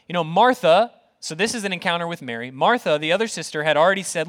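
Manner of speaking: ordinary delivery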